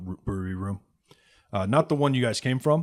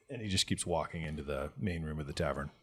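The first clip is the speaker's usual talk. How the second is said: normal talking voice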